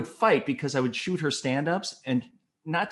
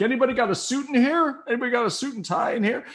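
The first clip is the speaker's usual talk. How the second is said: high-pitched shout round the room; calling out; 13 semitones higher, 3 dB louder, faster than usual. Retold or imitated. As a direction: imitate a boss calling out urgently. Director calling out to newsroom.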